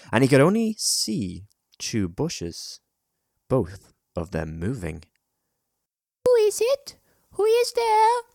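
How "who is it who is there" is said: put-on very high, loud child calling; child's voice. A narrator's reading. Child hidden in a bush challenges a stranger, high voice. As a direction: demand an answer in a child's voice. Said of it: "the voice was definitely a child's".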